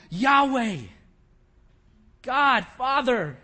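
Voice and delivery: loud, slow cry to God. Retold or imitated. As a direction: call out from the depths. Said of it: "He cries".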